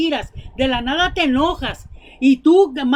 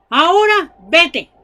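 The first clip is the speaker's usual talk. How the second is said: louder, slow, a touch higher: "Ahora vete"; angry dismissal; 4 semitones higher, 6 dB louder than usual. Retold or imitated. The frightened governor Félix orders Pablo out, curtly. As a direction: throw him out of the room.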